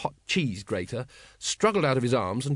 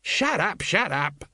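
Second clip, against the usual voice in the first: shouted; 6 semitones higher, slower than usual. A narrator's reading. Sergeant silencing talk of food, shouting. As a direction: snap it twice as a contained shout. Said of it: "shouted Colon".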